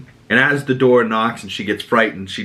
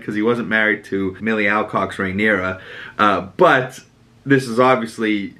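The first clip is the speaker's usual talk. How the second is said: ordinary delivery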